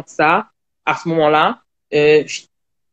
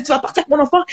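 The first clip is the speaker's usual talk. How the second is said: higher-pitched mock accusation; imitating the boyfriend's accusing tone; retold angry partner; 9 semitones higher, much faster than usual. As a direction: hurl it back at her as he did. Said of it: "Là, lui a dit".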